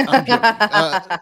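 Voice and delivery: high, loud, through laughter; laughing. Real, not acted. Guest teases the hosts about favourite episodes, laughing. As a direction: break up laughing as you take it back.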